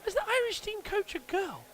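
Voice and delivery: mock high girly voice; squeaky comic question; high put-on voice.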